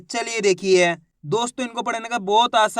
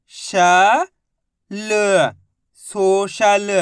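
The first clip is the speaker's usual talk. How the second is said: slow, louder syllable-by-syllable reading for repeating; slow spelling drill; 4 dB louder, much slower than usual. Shown as a demonstration. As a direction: drill each syllable so children can echo it. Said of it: "मेरे साथ साथ इनको repeat करना है".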